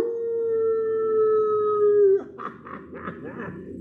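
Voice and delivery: squealing, very high, loud laugh; high puppet laugh. Acted. Puppet dog laughs with the kids after howling, high and loud. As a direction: let out a giddy puppy squeal.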